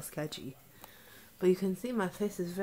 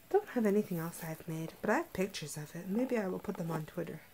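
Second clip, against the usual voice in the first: ordinary talk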